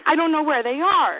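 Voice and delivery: ordinary delivery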